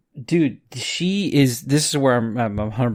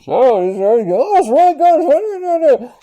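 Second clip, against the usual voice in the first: high, loud muffled parody; high mock of unintelligible talk in a noisy truck; mock garbled voice; 12 semitones higher, 7 dB louder than usual. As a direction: mimic a voice lost on a bad mic. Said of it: "It's like".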